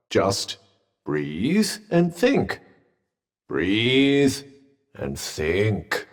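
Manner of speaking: slow, deliberate, word by word; slow coaching. Acted. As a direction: talk them down with long, spaced words. Coaching someone through a struggle.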